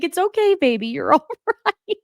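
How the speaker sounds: high, soothing, laughing through the words; laughing reassurance. Retold or imitated. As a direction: comfort him while giggling.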